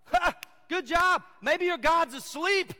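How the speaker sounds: loud, high taunting jeer; hammed-up jeering with a scoffing laugh; mocking. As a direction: taunt them with slow, exaggerated mockery. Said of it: "Elijah is mocking them in front of people".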